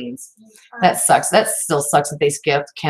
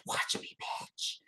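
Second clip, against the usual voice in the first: whispered, teasing object voice; hushed mock-menacing whisper; voicing the disc; 17 dB quieter, slower than usual. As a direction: whisper it as the disc's playful dare. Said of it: "It was, like, saying".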